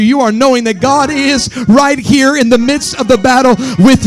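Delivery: loud chanted sermon cadence; whooping revival preaching; full-force preaching. Real, not acted. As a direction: preach it with rising power.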